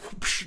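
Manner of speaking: quick imitated whoosh; soft airy whoosh for a fast catch; mouth-made swish sound.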